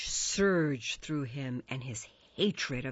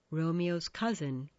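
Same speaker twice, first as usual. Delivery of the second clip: ordinary delivery, nothing marked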